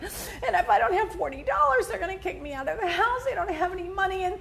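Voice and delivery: high-pitched mock tearful pleading; imitated crying plea. Host retells a woman begging for rent money, mock tearful. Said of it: "she's crying and crying".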